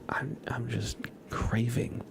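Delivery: ordinary talk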